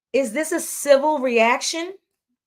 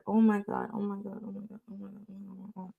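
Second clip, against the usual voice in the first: fading oh my God; 3 semitones lower, 11 dB quieter, much faster than usual. Real, not acted. Repeated exclamation fading out.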